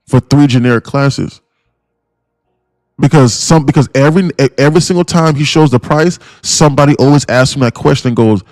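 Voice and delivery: ordinary talk, nothing marked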